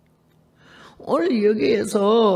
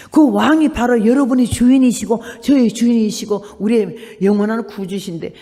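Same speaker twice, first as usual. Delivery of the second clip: loud, impassioned sermon climax; fervent preaching; 4 semitones higher, 5 dB louder than usual. Acted. Preacher rebukes the congregation, loud and impassioned. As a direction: drive the point home to the congregation.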